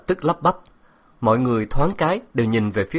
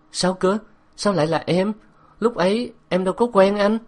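much higher, startled questioning; taken aback, protesting in a high voice; astonished; 10 semitones higher than usual. A narrator's reading. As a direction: pitch jumps up in baffled disbelief. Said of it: "Tôi kinh ngạc".

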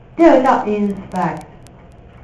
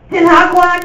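高声宣布答案; 用力大声喊出答案; loud answer reveal; 9 semitones higher, 7 dB louder than usual. Real, not acted. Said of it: "老师大声揭晓答案". (老师高声向学生揭晓答案)

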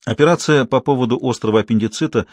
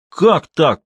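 indignant cry, higher and louder; shouting; 6 semitones higher, 5 dB louder than usual. A narrator's reading. Patient refused surgery protests to the doctor, shouting. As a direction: cry out in protest at being turned away. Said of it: "кричит мужик".

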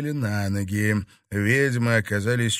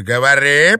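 loud, raised command; fierce one-word shout; shouted order; 4 semitones higher, 11 dB louder than usual. Acted. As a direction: demand an answer with full force. Witch repeats her order furiously.

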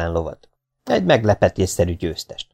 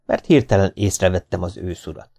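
ordinary talk

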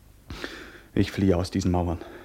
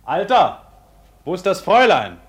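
loud, high urgent question; urgent call; 11 semitones higher, 10 dB louder than usual. Acted. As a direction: call out to the old man. Karl ruft den Alten an. (laut und drängend)

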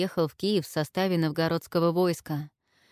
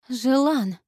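higher, louder reproach; reproachful; 7 semitones higher, 5 dB louder than usual. A narrator's reading. Olga reproaches Zhelan, reproachful call. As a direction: scold him fondly. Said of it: "укорила Ольга и голосом".

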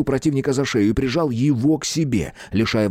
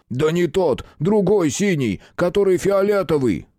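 shouting; 7 semitones higher than usual. Acted. Giant shouting instructions.

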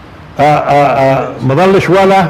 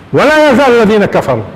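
raised, measured recital of the verse; Quran recitation; 4 semitones higher than usual. Acted. Teacher reciting the verse again to his students. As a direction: recite it with weight and lift.

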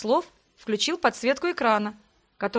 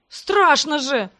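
a slow, louder retort; snapping back; 4 semitones higher, 6 dB louder, much slower than usual. A narrator's reading. Brother snapping at sister mid-fall, scared. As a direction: snap back defensively. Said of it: "огрызнулся брат".

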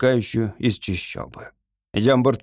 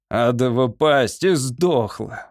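ordinary delivery, nothing marked

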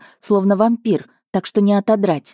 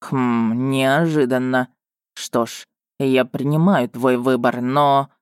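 musing prince; 3 semitones lower, slower than usual. A narrator's reading. Prince voice continues.

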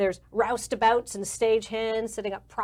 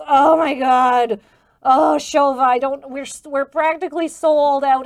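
loud, higher, anguished protest; stressed-out manager; 5 semitones higher, 12 dB louder than usual. Acted. Manager told the acrobats can't perform, stressed. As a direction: wail it, then stumble through the plea.

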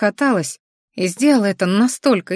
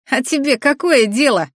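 mildly defiant retort, pitched up; higher, cocky comeback; 6 semitones higher than usual. A narrator's reading. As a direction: toss it back as a challenge. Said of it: "ответил он вызывающе".

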